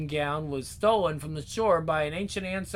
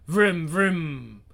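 vocal scooter revving; engine noise imitation; 3 semitones lower than usual.

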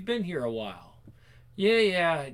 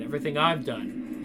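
usual speaking voice

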